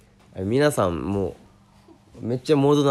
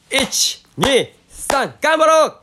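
high, loud rallying cheer; rally the viewers like a coach; 13 semitones higher, 4 dB louder, much slower than usual. Acted. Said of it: "エールを送ります". (高く大きな声で掛け声)

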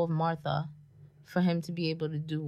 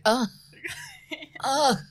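louder, fed-up groans; annoyed groans; 6 semitones higher, 4 dB louder than usual. Retold or imitated. Imitating Claudia's temper, irritated groans. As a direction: huff like someone out of patience. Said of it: "Claudia's temper was just like".

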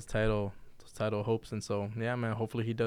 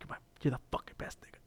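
choked-up whisper; 12 dB quieter, faster than usual. Retold or imitated. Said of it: "how Mike gets choked up when he gets emotional".

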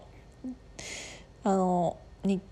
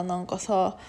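usual speaking voice

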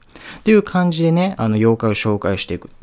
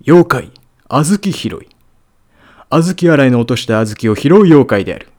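narrator voice; 4 semitones higher, 8 dB louder than usual. Shown as a demonstration. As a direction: announce it like a TV narrator. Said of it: "ナレーター風に".

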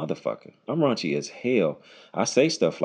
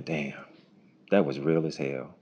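low, soft understated reaction; impressed listener; 4 semitones lower, 6 dB quieter than usual. Retold or imitated. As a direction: murmur it as you walk away. Voicing an impressed listener walking away.

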